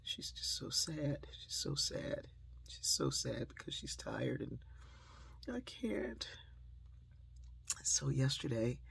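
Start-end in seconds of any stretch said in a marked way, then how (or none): 0.0-4.6 soft, hushed mock-sad telling; quiet, put-on sorrow; mock sadness. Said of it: "then she goes into tears". 5.5-6.3 high, soft little 'crying' voice; small, hushed mock whimper; imitated tearful child. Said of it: "then she goes into tears".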